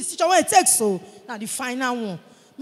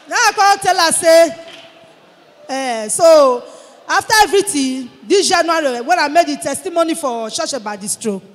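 loud testimony; 8 dB louder than usual. Real, not acted.

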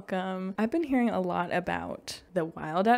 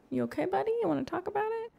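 cooing to a pet; 12 semitones higher, much faster than usual. Real, not acted. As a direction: coax a sad little buddy gently. Gamer talking to sad character.

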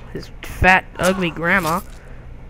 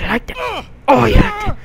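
mock taunt; 9 semitones higher than usual. Taunting victim, shouting.

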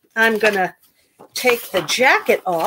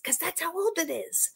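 ordinary talk

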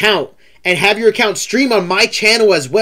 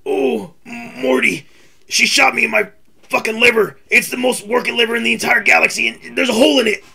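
higher, scratchy cartoon scientist complaining; Rick impression; 6 semitones higher than usual. Shown as a demonstration. Streamer doing his Rick voice for the interviewee, griping. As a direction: whine about the shot liver like Rick. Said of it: "it sounded just like him".

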